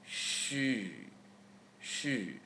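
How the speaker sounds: xu as a soft, drawn-out "she" through rounded lips. Shown as a demonstration. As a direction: round the lips and hold xu, tongue flat. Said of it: "We shape our mouths to u".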